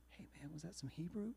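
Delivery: hushed, quick confidential whisper; imitated whisper. Bar patron asking the musician privately, quick whisper. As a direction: whisper it like a furtive question. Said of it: "Why are you whispering?".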